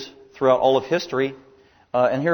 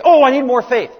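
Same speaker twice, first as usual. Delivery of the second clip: high, loud mock plea for more faith; mock whiny believer; 11 semitones higher, 9 dB louder than usual. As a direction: imitate a believer begging for faith. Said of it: "You can't just muster up faith".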